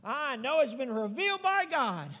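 loud, ringing declaration; emphatic affirmation. Acted. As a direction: proclaim it loudly to the congregation. Jesus affirming Peter, voiced by preacher.

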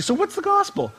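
high curious question; someone asking. Retold or imitated. A stranger asking a believer, high curious question. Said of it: "if somebody were to sit down and ask you".

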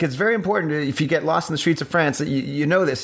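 lightly voiced boy explaining earnestly; a hint of an earnest kid. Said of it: "he's like".

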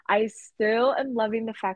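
ordinary talk, nothing marked

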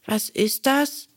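put-on high, slow wondering boy; puzzled puppet boy voice. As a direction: ask it like a curious child. Bengele asks.

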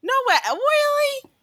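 put-on very high, playful amazement for a baby; baby-talk voice. Answering a babbling baby, high mock-amazed baby talk. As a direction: squeak out hammed-up interest, pitch climbing. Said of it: "every time a baby says something to me".

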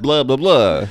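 booming mock-vampire impersonation; Hotel Transylvania voice. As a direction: throw out a loud cartoon vampire catchphrase. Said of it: "I don't sound like that".

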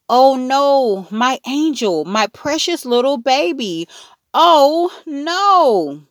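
high, loud wail of dismay; moaning dismay.

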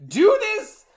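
high, loud, hammed-up indignation; mock outrage. Podcaster imitates Ben scolding townspeople over Sarah, mock-outraged. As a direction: wail the complaint like a wronged novelist. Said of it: "so Ben's very disappointed".

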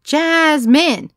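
high, loud cheering chant; hollered. A narrator's reading. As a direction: whoop it like a sports crowd. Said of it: "whooped and hollered".

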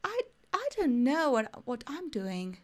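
put-on lightly done Australian accent, slow. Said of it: "con su acento australiano hermoso". (acento australiano suave y lento)

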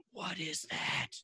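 high, soft whisper of wonder; imitated awed whisper. Boy asking mom about the anime, awed mock whisper. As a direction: whisper it like an amazed kid.

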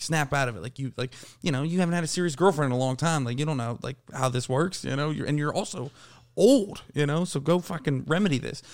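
normal talking voice

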